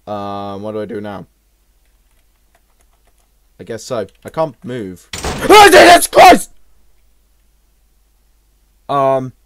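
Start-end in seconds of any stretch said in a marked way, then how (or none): 5.1-6.5 very high, loud shriek of fright; real terrified yelp; startled scream. Real, not acted. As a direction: scream out at a sudden scare. Second jump scare.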